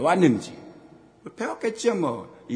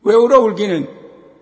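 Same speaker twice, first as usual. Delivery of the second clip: loud scolding; 9 semitones higher, 14 dB louder, slower than usual. Retold or imitated. Father scolds crying son.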